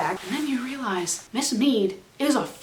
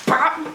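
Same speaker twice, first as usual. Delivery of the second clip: high, popped imitation of a hit; punch sound effect; 8 semitones higher, 3 dB louder than usual. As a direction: voice a cartoon bop. Imitates a punch after retort.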